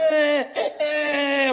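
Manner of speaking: short mock infant cries; baby crying. Comic shows how other babies cry, small high bleats. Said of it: "Other babies are crying like".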